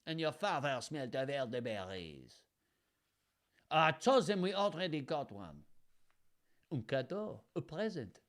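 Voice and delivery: silly French taunting, lightly voiced; mock French accent. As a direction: camp up the French guard. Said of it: "the accent that I'm channeling".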